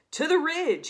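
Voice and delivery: proud proclamation. Acted. Walrus sending Wilf on to the ridge, proud proclamation. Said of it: "he proclaimed with his chin in the air".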